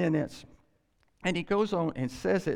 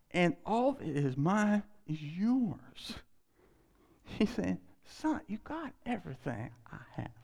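tender father; 3 semitones higher than usual. Acted. Father tenderly answers son.

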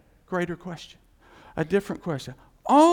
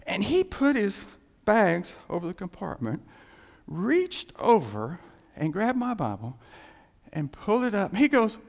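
ordinary delivery

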